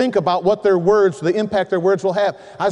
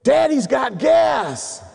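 put-on child announcing; 6 semitones higher, much slower than usual. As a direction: announce it to the whole bus like a toddler. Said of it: "my daughter stands up ... and goes".

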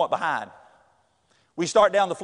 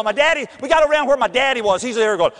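usual speaking voice

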